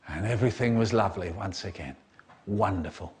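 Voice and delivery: low, quick, understated sign-off; deadpan refrain. A narrator's reading. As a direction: drop low and wrap it up drily.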